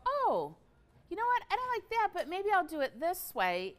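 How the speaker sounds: high-pitched, bright imagined customer thinking aloud. Pretend. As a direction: pitch way up, playful inner voice. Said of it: "maybe you go".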